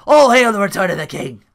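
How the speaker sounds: hammed-up heel promo shout; mock promo shout. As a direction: bellow it like claiming the ring. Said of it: "I was like".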